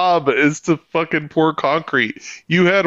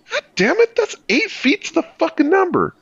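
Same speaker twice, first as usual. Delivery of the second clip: pitched up, quick, fed-up swearing; high exasperated curse; 10 semitones higher, faster than usual. Retold or imitated. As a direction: swear at the tape measure in disbelief. Retells cursing after measuring.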